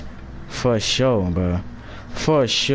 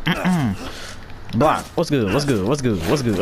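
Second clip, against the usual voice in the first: usual speaking voice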